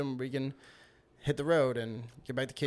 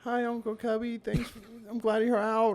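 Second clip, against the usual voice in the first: higher, subdued imitation of a sad child; sad niece; 8 semitones higher than usual. Sad niece greeting her uncle after release, imitated sadly. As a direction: voice a downcast little niece, pitched up. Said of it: "she looks really sad".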